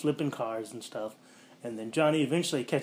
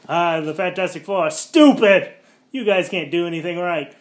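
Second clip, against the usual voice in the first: higher, much louder angry rant; mock furious rant; 6 semitones higher, 13 dB louder than usual. Captain Holt berates the Fantastic Four, mock furious yell. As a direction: berate them like an irate officer.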